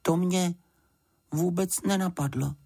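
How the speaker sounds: high, halting little admission; Paddington crestfallen. A narrator's reading. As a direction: admit it sheepishly, high, with a pause. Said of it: "řekl Paddington schlíple". (schlíple, vysokým hláskem)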